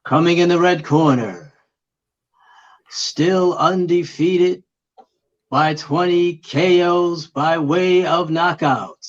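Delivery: slow, drawn-out ring announcer; boxer announcer voice. Pretend. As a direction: stretch the name out big for the crowd. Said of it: "boxer announcer voice".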